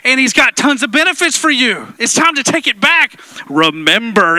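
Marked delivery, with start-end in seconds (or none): 3.5-4.4 a hint of Mufasa. Echoing the movie line.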